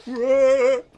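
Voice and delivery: high, loud startled yelp; startled cry. Acted. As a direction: cry out in mock alarm. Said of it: "Rufus and Milo are like".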